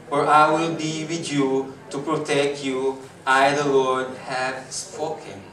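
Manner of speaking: slow, loud Bible reading to the congregation; scripture reading. Acted. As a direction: proclaim the verses steadily. Reads scripture aloud. (malakas at mabagal na pagbasa ng Bibliya)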